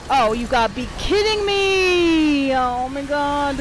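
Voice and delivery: loud, high, stretched-out wail; exasperated disbelief. Real, not acted. Player cannot believe the game, exasperated wail. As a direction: wail in protest, slowing down.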